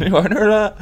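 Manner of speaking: high, loud, stretched sting; mock news alert. Host repeating the takeover alert at full volume. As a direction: belt it like a jingle.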